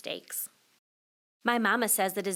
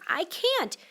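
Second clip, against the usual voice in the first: put-on whiny child; 8 semitones higher than usual. Kid refuses.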